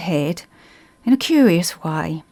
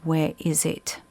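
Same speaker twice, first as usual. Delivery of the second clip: hushed, cracked and low; a dry, cracked ghostly rasp; 6 dB quieter than usual. A narrator's reading. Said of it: "A low, cracked voice said".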